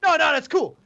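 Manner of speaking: mocking high-pitched brush-off; interrupting. Friend mimicking him talking over the stranger, interrupting. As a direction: imitate someone obnoxiously cutting in. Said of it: "you're just interrupting him".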